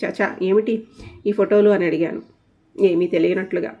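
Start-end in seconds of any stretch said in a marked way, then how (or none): none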